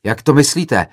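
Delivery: loud, high, indignant demand; agitated yell. A narrator's reading. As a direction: shout back, offended. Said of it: "zařval rozčileně jiný student".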